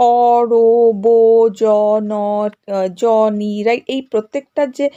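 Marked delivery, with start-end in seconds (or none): 0.1-3.5 "অ, রো, বো" and the rest as held, separate syllables; isolated syllables. Shown as a demonstration. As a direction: count out "অ, রো, বো, জ, ন" one by one with pauses. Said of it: "এইভাবে ধরো পেয়েছি". ("অ, রো, বো" আলাদা করে টেনে টেনে)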